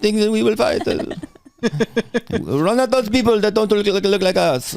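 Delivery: high, halting girl commander with mock French accent; rally the troops in a high, faltering put-on voice; Joan of Arc. Young French leader sending troops to fight, high comic impression.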